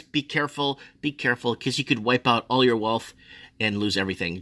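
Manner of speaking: usual speaking voice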